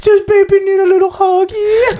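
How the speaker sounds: put-on high cooing baby talk; cutesy baby voice. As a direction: coo it like to an infant.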